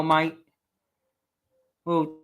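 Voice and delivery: normal talking voice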